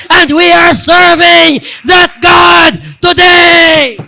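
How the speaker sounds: shouting high and loud, slowing on the last line; building pulpit shout; preaching at full force. Acted. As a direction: rouse the church to faith. Preaching climax.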